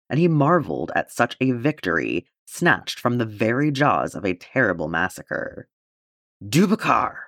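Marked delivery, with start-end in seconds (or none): 6.5-7.1 sudden shout. A narrator's reading. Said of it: "A sudden shout behind him".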